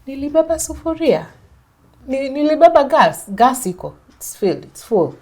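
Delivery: exasperated protest. Retold or imitated. Arguing with husband over belongings.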